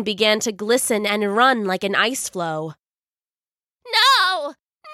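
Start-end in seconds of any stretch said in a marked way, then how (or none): 3.8-4.9 high, shrieking no as she melts; screaming cry. Acted. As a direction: scream it in despair. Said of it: "she cried".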